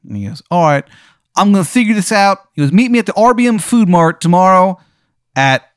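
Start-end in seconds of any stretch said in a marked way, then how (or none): 0.5-2.4 high, loud, hammed-up Maryland accent; a bad Maryland accent pitched way up; overdone Maryland accent, loud and high; terrible Maryland accent. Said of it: "His accent is so bad". 2.7-5.7 high, loud, hammed-up Maryland accent; a bad Maryland accent pitched way up; overdone Maryland accent, loud and high; terrible Maryland accent. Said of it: "His accent is so bad".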